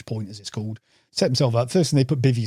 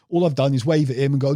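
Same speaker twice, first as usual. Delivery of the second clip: usual speaking voice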